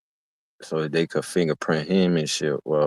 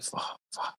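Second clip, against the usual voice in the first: whispered curse; 19 dB quieter than usual. Retold or imitated. Frustrated cellmate can't scrub mark.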